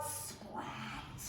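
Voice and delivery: ordinary delivery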